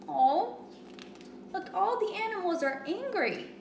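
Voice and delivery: ordinary delivery, nothing marked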